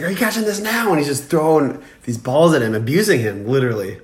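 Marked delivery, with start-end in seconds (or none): 0.0-0.9 high-pitched mock taunt from a coach; imitated abusive coach. Imitating the coach throwing balls at Tom, mock taunt. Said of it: "He's like".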